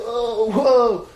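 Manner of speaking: loud, high imitation of a rowdy crowd; mock drunken whoa. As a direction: whoop like drunks watching a game. Imitating drunk crowd at bottle game.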